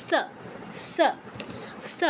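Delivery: "স" as a hissed s at the upper teeth; dental sa. Shown as a demonstration. Teacher showing students the dental sibilant, "স" as sa. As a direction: say "স" as English s, never sh. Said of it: "উপরের পাটির দাঁতে উচ্চারণ করবে".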